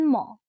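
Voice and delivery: ম with a long humming n before it; conjunct pronunciation demo. Shown as a demonstration. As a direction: sound it "nnn-mo" on ম. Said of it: "মূল উচ্চারণটা কি?".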